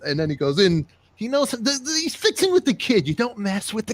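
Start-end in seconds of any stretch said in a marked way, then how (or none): 1.2-3.9 much higher, excited hype; high excited plug for the show; hyped-up shout-out. Acted. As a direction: talk him up like a promo. Hyping up Fixing with the Kid.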